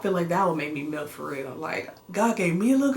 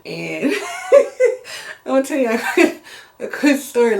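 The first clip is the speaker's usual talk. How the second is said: cracking up, louder and higher; laughing while talking; 5 semitones higher, 7 dB louder than usual. Real, not acted. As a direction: struggle to get the words out through laughter. Cracks up before telling story.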